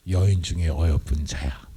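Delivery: low, slow lover's voice; Solomon's voice. Acted. Solomon calling his beloved, low and slow. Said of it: "솔로몬이 등장을 하는 거예요".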